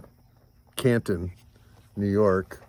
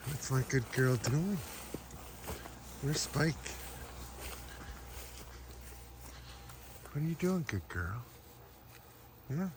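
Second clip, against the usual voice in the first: talking to a dog; 4 semitones higher, 15 dB quieter than usual. Real, not acted.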